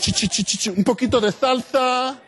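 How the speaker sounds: chanted salsa sound effects climbing high; comic action noises, rising into a flourish; Puerto Rican Matrix dad. Retold or imitated. Dad moving like The Matrix, high comic salsa flourish. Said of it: "like a Puerto Rican version of The Matrix".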